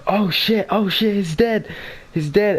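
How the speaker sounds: panicked high shout; panicked yelling. Retold or imitated. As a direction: yell it out like a friend who thinks he's dead. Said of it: "Andy then started yelling out".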